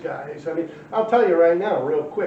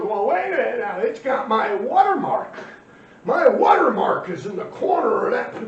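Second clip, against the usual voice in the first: whiny complainer; 6 semitones higher, 5 dB louder than usual. Retold or imitated. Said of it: "the guy goes".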